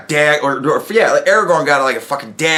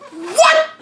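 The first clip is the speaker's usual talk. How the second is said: a very high, rising what; incredulous what. Real, not acted. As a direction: shoot it up in disbelief. Outraged at Gimli's choice.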